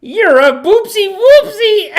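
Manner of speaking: put-on very high, loud, drawn-out child voice; hammed-up shrieky kid taunt; little girl's comeback. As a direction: squeal the taunt like a small kid. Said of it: "her daughter goes".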